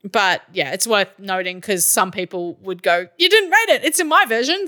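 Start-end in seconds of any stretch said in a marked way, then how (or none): 3.2-4.7 high-pitched mock protest; mock complaint. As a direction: whine like an indignant listener, much higher. Mimics complaining listeners.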